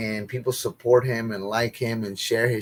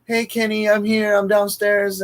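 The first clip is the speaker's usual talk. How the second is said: higher, teasing imitation of a pestering friend; mocking friend's voice; 8 semitones higher than usual. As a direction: parrot his nagging call, pitched up. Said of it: "like, telling me".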